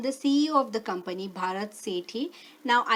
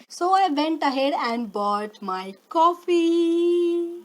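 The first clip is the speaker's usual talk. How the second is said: high, slow and loud; triumphant announcement; 6 semitones higher, 5 dB louder, slower than usual. Acted. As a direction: proclaim it like a victory.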